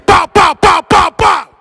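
high, loud shouted pop pop; shouted ad-lib. Shown as a demonstration. Host shows a passerby the ad-lib, loud shout. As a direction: bark the ad-libs like a drill rapper. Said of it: "Let me hear you say".

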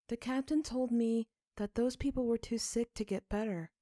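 put-on high, earnest little girl voice; a girl repeating what the captain said; child Sophie's voice. A narrator's reading. Sophie speaks to her father.

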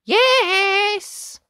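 high, loud, long yes; eager yes. A narrator's reading. Ned answering the bedtime call, high eager shout. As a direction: holler back cheerfully. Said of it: "mostly Ned would answer".

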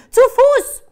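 high, very loud command; shouting. A narrator's reading. Child chasing the runaway dog, high shout. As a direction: yell it after the dog. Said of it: "schreit sie".

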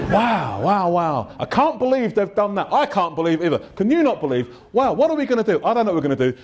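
exaggerated shared grievance; mock indignation. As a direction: commiserate like outraged neighbours, playfully. Acts out neighbours bonding over complaints.

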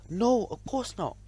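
high, drawn-out protest; startled denial. A narrator's reading. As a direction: deny it as if jolted from a daydream. Startled dreamer denies daydreaming.

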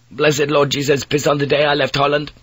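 put-on a weary young Dutch sailor pleading; Dutch mate.

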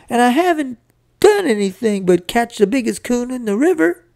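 put-on high, aggrieved boy's protest; hurt, tearful wail. A narrator's reading. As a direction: protest the unfairness, nearly bawling. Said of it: "really did get tuned up".

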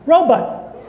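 robot high and falling, ending "but"; robot with a short "but" ending. Shown as a demonstration. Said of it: "If you say robot".

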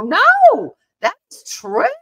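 high, loud insistence; insisting. Retold or imitated. Teller insisting to doubters the story is true, high and loud. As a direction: squeal out that it's true. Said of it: "And you go".

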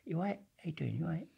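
soft, high-pitched friendly greeting; Rambo's voice. Retold or imitated. Mimicking big Rambo to the listeners, soft and quiet. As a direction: copy how Rambo talks, quiet and light. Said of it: "he talks like this".

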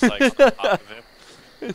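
loud. Acted. Hammed-up loud ending.

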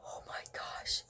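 breathy whispered worry; whispering. Real, not acted. Whispered worry, child listener.

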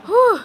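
high, loud whew of relief; breathless whew. Real, not acted. Winded after dragging the chair, a loud relieved whew.

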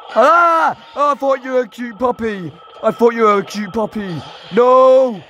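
real high frightened shouting; frightened shrieks. Real, not acted. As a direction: yelp in fright at the robot. Mangle attacks again.

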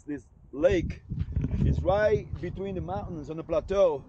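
ordinary talk

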